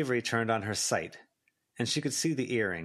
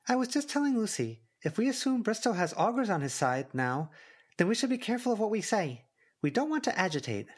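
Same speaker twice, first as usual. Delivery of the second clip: put-on much higher, quick girl voice; Verona voice; 13 semitones higher than usual. A narrator's reading. Verona warning Avery about augurs, high and quick. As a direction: pitch way up and hurry for Verona.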